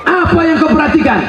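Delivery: high, fast, loud mocking question; mocking shout. Preacher mocking the crowd's vanity, loud and high. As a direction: fire the question at them, scoffing.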